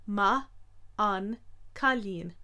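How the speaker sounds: Ma an cailín slow with the N sounded; each syllable apart; said slowly. Shown as a demonstration. Teacher showing the full slow form.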